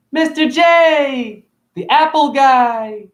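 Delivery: high, loud, slow drawn-out announcement; grand self-introduction. Acted. Presenting himself to viewers as the shop's apple guy, high and drawn out. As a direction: sing out his own nickname like a showman. Introducing his shop persona.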